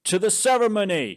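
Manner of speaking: high, loud cult leader's proclamation; rallying cry. Acted. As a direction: call the whole crowd to action. Cult leader rallies the crowd.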